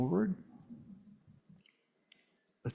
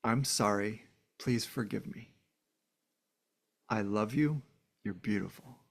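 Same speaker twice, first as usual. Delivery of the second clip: soft mantra; 4 semitones lower, 5 dB quieter than usual. Acted. Reciting the mantra in meditation.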